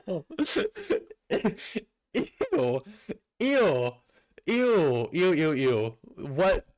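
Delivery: high, grossed-out ews; disgusted ew. Real, not acted. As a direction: recoil from the picture. Disgusted at drawing.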